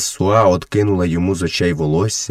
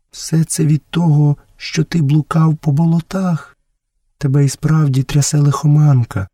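higher-pitched motherly voice; concerned mother; 7 semitones higher than usual. Acted. Messua blames Mowgli's fever on the swamps, concerned mother. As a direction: explain it as a mother would, pitched up.

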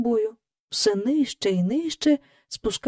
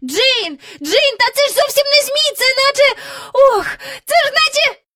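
very high, louder cry; excited shout; 4 dB louder than usual. Acted. Michael cries to Jane about the figure, very high excited shout. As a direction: blurt out in amazement. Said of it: "Раптом Майкл закричав".

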